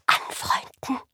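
whispered Anfreunden, barely a breath. A narrator's reading. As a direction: breathe the word in disbelief. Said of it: "hauchte Eddie". (gehauchtes Flüstern)

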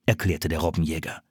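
ordinary delivery, nothing marked

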